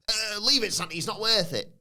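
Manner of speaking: high mock Scouse actor line; hammed-up footballer acting, pitched high; imitating Steve McManaman. Podcaster quoting Steve McManaman's film line, high and hammy. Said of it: "Careful, Steve".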